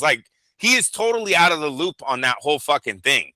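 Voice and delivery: usual speaking voice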